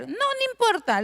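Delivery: high sing-song "no, no importa"; imitating herself. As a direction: pitch it way up, brushing off the loss. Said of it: "le decía yo".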